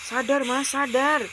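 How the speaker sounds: high-pitched, urgent appeal; urgent pleading. Acted. Wife begging husband to snap out of it, pleading. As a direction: plead with a husband to come to his senses.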